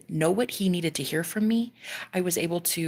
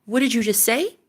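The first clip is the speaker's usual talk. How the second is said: demanding; 4 semitones higher, 5 dB louder than usual. Retold or imitated. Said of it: "And I said".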